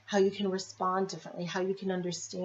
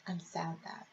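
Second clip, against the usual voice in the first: very soft, almost gone; much softer; 11 dB quieter than usual. Shown as a demonstration. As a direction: let it fade right out.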